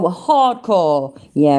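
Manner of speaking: ordinary talk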